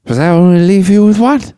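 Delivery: high, loud punchline rising at the end; exaggerated movie quote. Quoting Dad's favorite line, in an exaggerated high voice. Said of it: "Really over-exaggerated".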